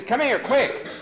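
high, loud, excited holler; urgent call. Acted. Cowhand who saw the mountain close, urgent. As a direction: holler for him to hurry. Cowhand calls Tom back.

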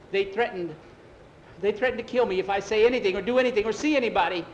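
high, loud, fast rush; scared man gabbling his confession; frightened outpouring. Acted. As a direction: pour it out in acted fear. Frightened man tells of threats.

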